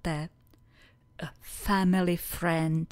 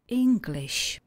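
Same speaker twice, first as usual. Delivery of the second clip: "English" said clearly higher, falling at the end; higher repeat; 5 semitones higher than usual. Shown as a demonstration. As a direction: lift the pitch of "English" for the model. („English“ výš, s klesající melodií)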